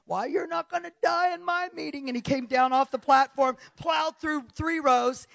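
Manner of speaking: high, loud commanding cry; preacher shout. Retold or imitated. Evangelist shouting at death in his meeting, loud and high. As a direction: roar it like the evangelist defying death. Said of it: "and he said".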